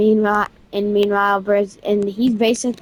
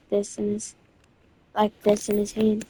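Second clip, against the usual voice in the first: ordinary delivery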